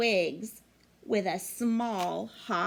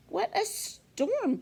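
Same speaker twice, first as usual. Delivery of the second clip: much higher, chirpy little bird voice; twittering bird; 11 semitones higher than usual. Acted. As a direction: chirp it like a tiny wren. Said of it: "twitters wren".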